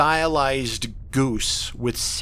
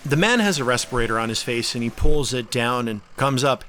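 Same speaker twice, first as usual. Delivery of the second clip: ordinary talk, nothing marked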